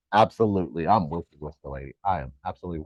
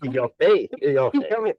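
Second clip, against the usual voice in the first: higher teasing victory taunt; playful gloating; 4 semitones higher, 3 dB louder than usual. Pretend. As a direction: crow over being proved right, playfully. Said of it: "Gee, ego activation".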